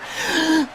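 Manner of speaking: high, quick intake of breath; sharp gasp. Acted. Brother catching himself after the outburst, a high gasp. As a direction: catch yourself mid-rant.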